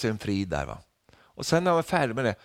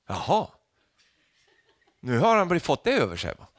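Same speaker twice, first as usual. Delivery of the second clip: normal talking voice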